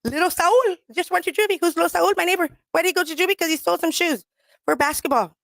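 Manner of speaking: high retelling of both sides; retold chat with the mayor. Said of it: "I was just like".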